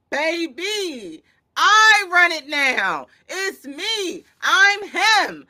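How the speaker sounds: slow, high, loud bragging; cocky boast. Retold or imitated. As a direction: crow it like you own the court. Voicing Wemby's boast.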